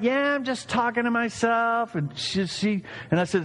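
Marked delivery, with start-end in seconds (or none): 0.0-1.9 high, drawn-level reply of a grandma; mother-in-law impression. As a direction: answer as a teased mother-in-law, pitched up. Playing mother-in-law answering his teasing.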